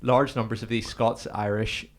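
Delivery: ordinary delivery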